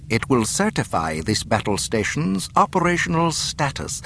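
formal, self-satisfied official speech; courteous villainous governor. Acted. Governor inviting the captive to his ceremony, courteous menace. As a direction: lay out the threat as an invitation.

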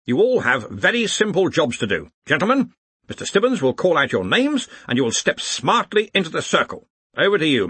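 brisk leader briefing his staff; brisk Arch-Chancellor. Acted. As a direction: address the assembled wizards with bluff authority. Ridcully instructs the wizards.

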